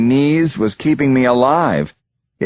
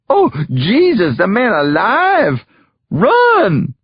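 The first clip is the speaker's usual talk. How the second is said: terrified shriek; 10 semitones higher, slower than usual. A narrator's reading. Boys shrieking as the hanged man moves. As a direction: scream and flee. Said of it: "The boys shrieked in terror".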